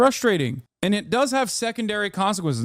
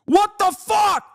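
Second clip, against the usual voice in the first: outraged shout; slower than usual. Real, not acted. Streamer shouting at the allegations. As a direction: blurt it out in disbelief.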